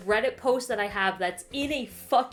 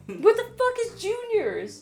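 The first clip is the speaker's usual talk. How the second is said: exasperated outburst; 13 semitones higher, 4 dB louder than usual. Real, not acted. Exasperated rant.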